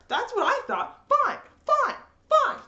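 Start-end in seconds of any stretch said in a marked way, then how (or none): 1.1-2.6 high, sing-song mock agreement; sarcastic. Fan sarcastically accepting the plot, high and mocking. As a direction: pretend to shrug it off. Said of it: "sarcastic mock acceptance".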